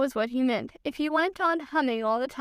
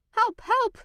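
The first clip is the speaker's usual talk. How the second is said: much higher, squeaky help, help; high cry for help; 10 semitones higher than usual. A narrator's reading. Pooh testing his squeaky voice by calling for help. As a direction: call out in a squeaky voice.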